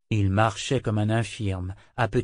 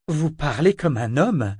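slow, surprised blurt, a bit raised; blurted exclamation; 4 semitones higher, 4 dB louder than usual. A narrator's reading. Malta to the governor, blurting out in surprise. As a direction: let it slip out before thinking. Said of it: "s'exclama-t-elle à l'étourdi".

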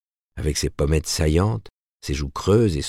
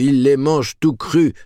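barker call at full peak, higher still; loud carnival barker; 10 semitones higher, 5 dB louder than usual. Acted. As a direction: top the shout even higher and louder. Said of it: "en imitant le bonimenteur".

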